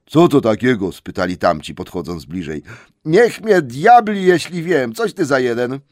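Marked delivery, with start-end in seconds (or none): 0.1-0.9 gruff troll question. Acted. Trolls ask, louder voice. 3.1-5.8 puzzled troll. Acted. Troll wonders aloud at the hobbit, loud and high.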